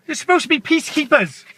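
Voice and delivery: normal talking voice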